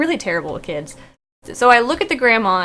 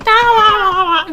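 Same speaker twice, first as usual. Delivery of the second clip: high, loud babbling toddler imitation; oblivious little kid; 8 semitones higher, 6 dB louder than usual. Little kid ignoring the police threat, oblivious babble. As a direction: babble along like a carefree kid. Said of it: "little kid is still just like".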